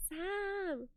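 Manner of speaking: soft, high British 'Sam'; British accent. Shown as a demonstration. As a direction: try the name in a British accent. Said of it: "how do you say why in a British accent".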